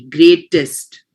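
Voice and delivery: "Greatest" with the middle T hit hard and the last T light; stress on the middle T. Shown as a demonstration. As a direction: lean on the first T of "Greatest". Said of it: "more emphasis on the middle T rather than the last T".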